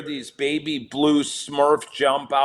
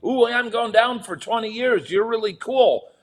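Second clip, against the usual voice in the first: higher, sarcastic gushing over a long sentence; mock admiration; 8 semitones higher than usual. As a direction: fake awe to mock the inmates' bragging.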